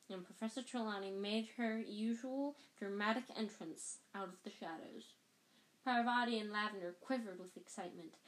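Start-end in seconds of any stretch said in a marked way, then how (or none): none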